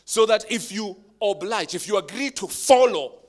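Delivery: loud soaring preacher voice; preaching at full force. Real, not acted. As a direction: preach it out, half singing. Preaching climax.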